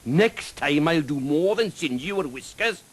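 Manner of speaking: high, slow dragon voice; threatening dragon. A narrator's reading. Dragon warns the knight off, threatening.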